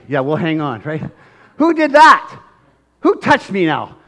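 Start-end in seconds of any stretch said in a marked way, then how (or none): 1.6-4.0 high, loud, insistent demand to know; Jesus demanding. Acted. Jesus demanding of the crowd, loud and high. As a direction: call out over the crowd. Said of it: "And Jesus goes".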